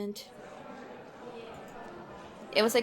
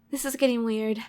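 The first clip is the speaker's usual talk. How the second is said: ordinary talk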